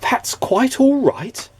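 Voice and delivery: high, slow amazed question; amazed disbelief. A narrator's reading. Mister Good marvelling at kindness, high and amazed. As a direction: savour each word as if unheard of.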